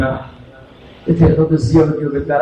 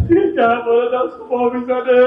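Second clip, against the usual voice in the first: ordinary talk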